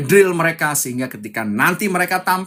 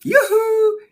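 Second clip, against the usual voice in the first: high, cheerful yuhu; excited whoop. Real, not acted. Coach cheering his livestream viewers, excited whoop.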